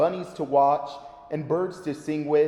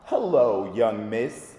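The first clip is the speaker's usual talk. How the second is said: friendly wolf; 6 semitones lower, slower than usual. Acted. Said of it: "said a friendly sounding voice".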